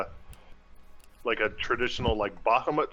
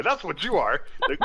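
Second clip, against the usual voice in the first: ordinary delivery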